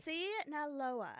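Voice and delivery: SINALOA slow and firm, falling at the end; decisive, stretched-out SINALOA; confident. Real, not acted. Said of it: "Just, just say it confidently".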